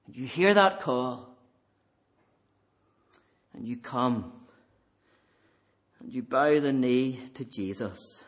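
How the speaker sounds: usual speaking voice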